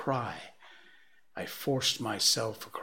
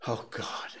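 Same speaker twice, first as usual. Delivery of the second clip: breathy despairing "Oh God"; a man at the end of his tether; anguished muttering; 5 dB quieter than usual. A narrator's reading. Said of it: "he'd say over and over again".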